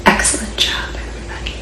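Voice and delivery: soft whispered praise. Real, not acted. Librarian whispering praise to children.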